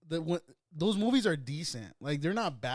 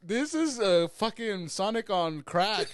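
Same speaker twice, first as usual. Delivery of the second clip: laughing; 7 semitones higher, 3 dB louder, slower than usual. Real, not acted. Laughing at Sonic design.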